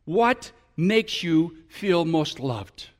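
very slow, each word weighed; slow question. Shown as a demonstration. Pastor modeling the question couples should ask, slow. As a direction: ask it slowly and gently. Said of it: "ask each other this question".

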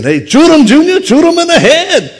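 man in a skiff yelling at Junior to shoot the gator; swamp hunter shouting. Retold or imitated. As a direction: holler it like a bayou hunter egging on his son. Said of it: "I hear him saying".

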